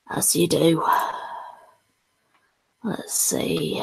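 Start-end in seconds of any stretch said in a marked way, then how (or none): 2.8-3.8 hoarse whisper. Real, not acted. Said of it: "lost my voice".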